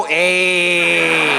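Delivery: lightly done Fonz greeting; comic doing a Fonzie hey to Henry Winkler; mock Fonzie hey. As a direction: toss off a cool Fonzie hey. Imagined calling out to Winkler.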